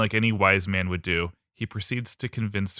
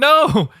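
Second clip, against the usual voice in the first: high shriek; 12 dB louder than usual. Pretend. Rabbit shrieks and runs away.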